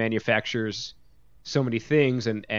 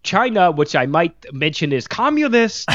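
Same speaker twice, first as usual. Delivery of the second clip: slow, louder, higher grandpa impression; hammed-up grandpa laying down his opinion; ranting grandpa; 5 semitones higher, 5 dB louder than usual. Co-host mocking his partner's verbatim quote, as a slow ranting grandpa. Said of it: "Just like somebody's grandpa just going".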